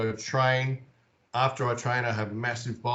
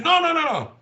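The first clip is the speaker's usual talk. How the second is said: much higher, louder imitated guide brushing him off; high insistent no, no, no from the guide; guide refusing; 7 dB louder than usual. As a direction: wave away his denial. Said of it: "She goes".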